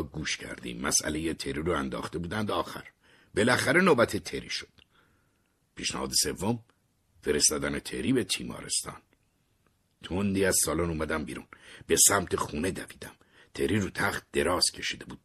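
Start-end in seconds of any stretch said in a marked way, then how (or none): none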